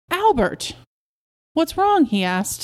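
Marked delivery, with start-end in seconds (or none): 0.1-0.7 high, sharp exclamation of the name; scolding, pitch shooting up then falling; shocked reproach. A narrator's reading. Wife catches husband's evasion, exclaims. 1.5-2.0 high-pitched innocent query; innocent, voice lifted right up; feigned innocence. A narrator's reading. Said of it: "soft and innocent".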